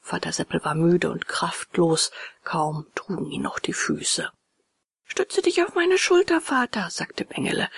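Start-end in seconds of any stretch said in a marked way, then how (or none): 5.1-6.9 put-on high, eager little boy's voice; a small boy offering help, pitched high; high boyish character voice; voiced puppet boy. A narrator's reading.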